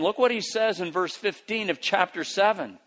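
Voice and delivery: ordinary delivery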